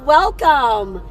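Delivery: ordinary talk, nothing marked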